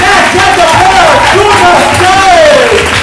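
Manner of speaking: loud, high, drawn-out stage introductions; announcer shout. Real, not acted. Host welcomes players to the stage, big announcer shout. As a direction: holler each name over the roaring crowd.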